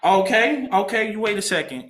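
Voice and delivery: high-pitched imitation of a woman's warning; warning lady. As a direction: mimic her putting a smart mouth on notice. Voices Nancy's warning reply.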